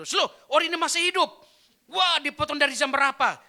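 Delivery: can't believe he's alive; astonished. Retold or imitated. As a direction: rescuers amazed the body speaks, fast and loud. Rescuers find him alive.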